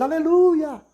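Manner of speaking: high-pitched mock praise cry; imitated fervent worshipper. Congregant praising at grim news in a sermon, imitated fervor. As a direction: imitate an overeager congregant's exclamation. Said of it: "alguém tá dizendo".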